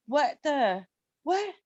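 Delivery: shocked disbelief. Retold or imitated. Telling the podcast host about a surprise kiss, a startled retold what.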